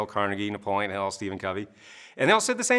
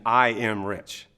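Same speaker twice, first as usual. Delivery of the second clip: slow, deliberate declaration; reciting a rote affirmation; slow statement; much slower than usual. Shown as a demonstration. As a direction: lay it down slowly, word by word. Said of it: "the statement or affirmation".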